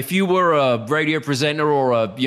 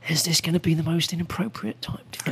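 hushed inner thought; 6 dB quieter, faster than usual. Retold or imitated. Pondering asking Bricktop.

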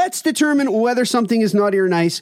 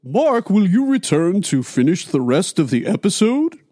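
lightly voiced jolly Santa; 3 semitones lower than usual. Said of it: "the Santa Claus voice".

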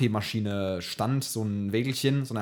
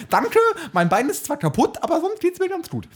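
much higher, louder silly voice; squeaky mock voice; 7 dB louder than usual. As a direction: squeak it out as a joke. Übertreibt Jammern im Scherz. (hohe Quietschstimme)

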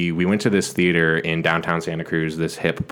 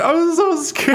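mock bawling; 3 dB louder, slower than usual. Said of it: "And I started bawling".

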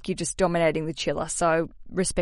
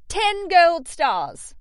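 slow, loud, high Australian drawl of ten gold stars; proud Australian cheer; Australian accent; 10 semitones higher, 6 dB louder, much slower than usual. Shown as a demonstration. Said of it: "as I'd say with my Australian accent".

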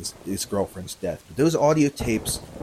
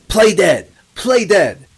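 loud, high urgent shout; punchy retold yell, raised in pitch; imitated screaming; 9 semitones higher, 10 dB louder, slower than usual. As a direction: shout it as an urgent command, retelling her screams. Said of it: "she starts screaming".

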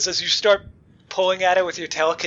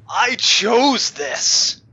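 loud, high, drawn-out villain declaration; defiant villain cry; 8 semitones higher, 7 dB louder, much slower than usual. Acted. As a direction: proclaim it slowly at full voice. Game master voices undead villain's defiant reply.

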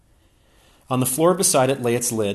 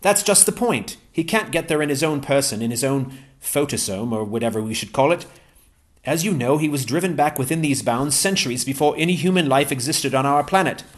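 higher-pitched, eager English gentleman; Ransom's voice; 6 semitones higher, 5 dB louder than usual. Acted. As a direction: pitch Ransom up, glad and keen.

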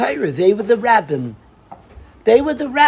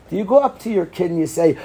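normal talking voice

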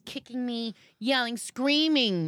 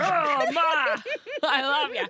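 higher, louder laughing Irish lilt; laughing leprechaun voice, higher and louder; mock Irish leprechaun; 8 semitones higher, 3 dB louder, slower than usual. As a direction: ham up a little Irish guy. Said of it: "The leprechaun?".

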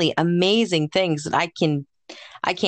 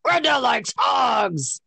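slow, higher put-on character shout; Grenda; 4 semitones higher, much slower than usual. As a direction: holler it like a rowdy cartoon character. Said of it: "I think I would wanna be Grenda".

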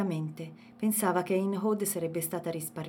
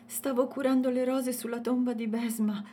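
breathless; 6 semitones higher than usual. A narrator's reading. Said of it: "ansimò Dalia".

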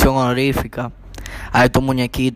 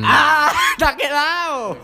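excited laughing; 12 semitones higher, slower than usual. Real, not acted. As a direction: cheer through laughter. Friends cheering a good impression.

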